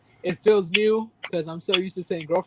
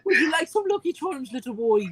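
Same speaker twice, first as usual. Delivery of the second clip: higher, hammed-up mock Irish leprechaun voice; mock Irish accent; 7 semitones higher than usual. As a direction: coax the boy in a silly Irish lilt. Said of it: "we gotta say something in Irish".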